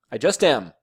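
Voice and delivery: loud, short angry retort; shouted. A narrator's reading. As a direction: shut the argument down with a shout. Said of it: "my mother shouted".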